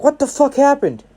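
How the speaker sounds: high-pitched shocked question; baffled. Acted. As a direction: ask it high, utterly thrown. Monoma finds his knee fine.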